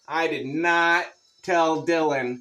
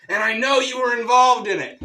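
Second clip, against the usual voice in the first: higher and louder, voiced as the accusing wife; comic's loud, higher mock of his angry wife; 8 semitones higher, 6 dB louder, faster than usual. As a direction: throw the blame at him hard.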